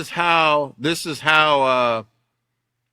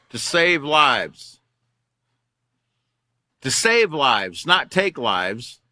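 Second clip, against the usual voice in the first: slow emphatic; much slower than usual. Real, not acted. Preaching the oath's meaning.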